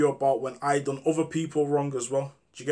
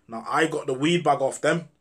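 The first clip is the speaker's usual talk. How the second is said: normal talking voice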